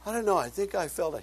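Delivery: imitating a man who remembers nothing; retold groggy friend. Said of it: "He goes".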